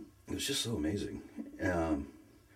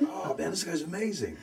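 higher, amazed praise; awed excitement; 11 semitones higher than usual. Retold or imitated. Retelling first hearing Alistair Begg, higher and awed. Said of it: "I'm just like".

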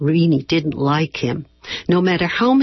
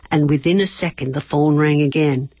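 ordinary talk, nothing marked

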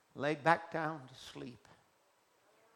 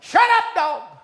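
screaming high at the dog; furious shout; 17 dB louder than usual. Acted. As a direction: miner screaming at his barking dog. Miner yelling at dog again.

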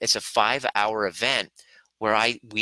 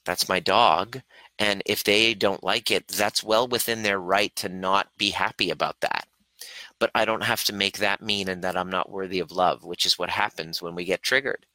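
normal talking voice

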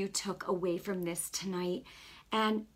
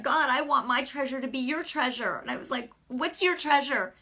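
raised, higher retold appeal to God; eager plea; 5 semitones higher, 4 dB louder than usual. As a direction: ask God eagerly, pitch lifted.

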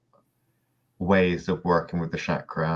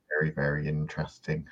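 dry, deadpan sarcasm; sarcastic; 3 semitones lower, 7 dB quieter, faster than usual. Real, not acted. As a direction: say it like you don't mean it. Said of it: "Well, not really".

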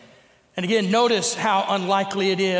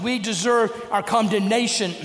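ordinary talk

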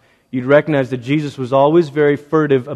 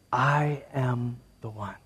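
much softer, slow, tender; soft reveal; 3 semitones lower, 12 dB quieter, slower than usual. Acted. Preacher voicing Jesus's tender reveal to the woman. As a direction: say it slowly and softly.

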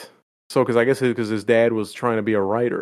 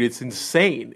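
ordinary talk, nothing marked

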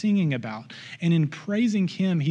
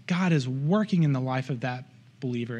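normal talking voice

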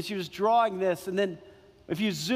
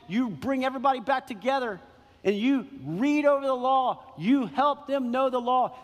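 preaching at full force; 6 semitones higher than usual. Acted. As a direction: drive the point home to the congregation.

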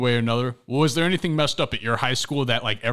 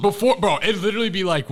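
ordinary talk, nothing marked

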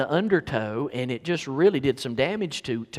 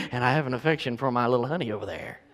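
sudden drop to a low, soft, quick murmur; tender, lightly amused aside to a sweetheart; fond aside; 3 semitones lower, 3 dB quieter, faster than usual. Real, not acted. Preacher to his wife in the service, softly affectionate.

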